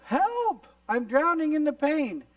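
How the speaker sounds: high, loud mock panic; acted cry for help. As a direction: call out as if you're going under. Acted cry for help while drowning.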